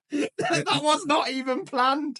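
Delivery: high, slow words squeezed through laughter; laughing. Real, not acted. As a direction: still laughing as he talks. Laughing at Doom coincidence.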